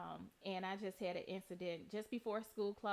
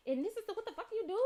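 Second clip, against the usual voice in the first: mock cursing mom; 8 semitones higher than usual. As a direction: rattle off the other mom's cussing. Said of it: "She was like".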